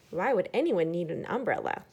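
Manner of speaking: usual speaking voice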